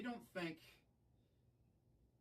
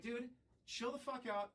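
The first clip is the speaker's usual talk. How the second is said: retold yell to chill; 10 semitones higher than usual. Calming a bandmate about to swing.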